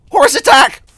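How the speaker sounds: shouting. Real, not acted. Horse charges in game.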